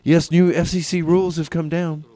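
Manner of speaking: normal talking voice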